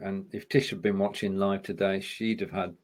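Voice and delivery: normal talking voice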